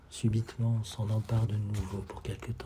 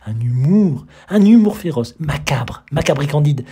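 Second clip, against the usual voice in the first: loud, high emphatic praise; exalted praise; 4 semitones higher, 13 dB louder than usual. Acted. Enthusiast loudly extolling the book's humour. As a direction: hammer each word of praise.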